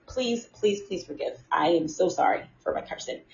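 soft, low plea for forgiveness; hushed apology. Real, not acted. Speaker begging forgiveness for cursing, softly apologetic. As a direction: drop the voice and beg pardon.

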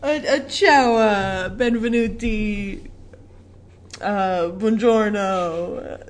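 put-on slow, hammy Italian accent; drawn-out fake Italian greeting; bad Italian accent. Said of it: "I was gonna do a bad Italian accent".

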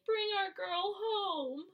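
slow, high, tearful plea; crying mom. Retold or imitated. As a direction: imitate a mother crying out. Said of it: "it shows Peter's mom crying".

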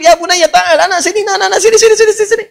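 put-on high, eager calling of the boy; calling the young man over. Teacher calling his student over, high and eager. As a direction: beckon him over, high and insistent.

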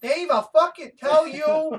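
high, loud, brash Long Island rant; Michael Rapaport impression. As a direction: rant at Dave like a fired-up New Yorker.

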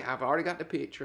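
ordinary talk, nothing marked

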